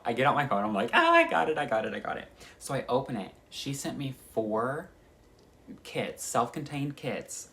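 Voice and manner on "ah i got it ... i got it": high, loud excited squeal; freaking out. Retold or imitated. At the mailbox, package from her friend: high excited squeal. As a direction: squeal it like the package just arrived. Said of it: "I immediately start freaking out".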